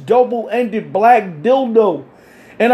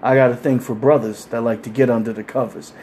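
mock-seductive calm line, lower and softer; 10 semitones lower, 4 dB quieter than usual. Woman in nightgown propositioning the orderly, lightly voiced calm. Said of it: "in a calm voice".

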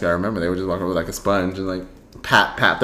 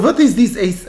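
mock French accent, higher; fake foreign language; 9 semitones higher than usual. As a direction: imitate a Frenchman baffled by AC. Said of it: "that's not even French".

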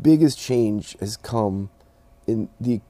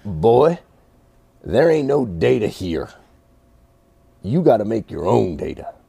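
lightly imitated Forest City accent, punched up; 4 dB louder than usual. Retelling a racer's answer to a newcomer, Forest City accent. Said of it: "Forest City accent I've ever heard".

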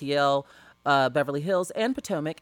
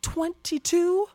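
shocked disbelief; 11 semitones higher, much slower than usual. Real, not acted.

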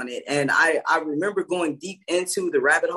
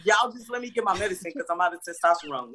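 usual speaking voice

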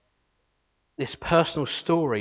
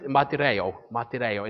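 "martyreo" with tapped, Greek-style r; Greek accent. Shown as a demonstration. Preacher showing congregation the Greek word, Greek accent. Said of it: "accent that you need to say it with".

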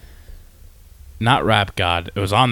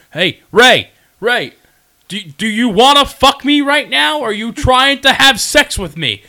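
mock yell; 11 semitones higher, 6 dB louder than usual. Retelling [name]'s outburst at [name] in a loud mock yell. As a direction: belt it out like a crazed heckler.

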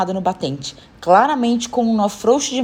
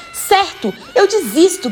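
high-pitched exasperated surrender; attempted shout; 9 semitones higher, slower than usual. A narrator's reading. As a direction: give up with a restrained cry. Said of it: "gritei, ou pelo menos tentei". (grito contido de desistência)

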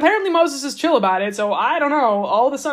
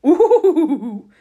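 words broken by a laugh, louder then easing; 4 dB louder than usual. Real, not acted. Host voicing the Lord's punishment, laughing through it.

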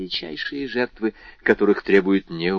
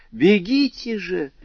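high, slow urging cry; urgent cry; 10 semitones higher, slower than usual. A narrator's reading. Friend urging the trapped thief to flee, high cry. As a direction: push him to run. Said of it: "крикнула мадемуазель Дармюльи".

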